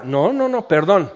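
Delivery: usual speaking voice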